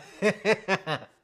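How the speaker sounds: loud booming burst of laughter; man laugh. Real, not acted. Streamer cracking up at chat's comment, loud laugh. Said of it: "then I let out my man laugh".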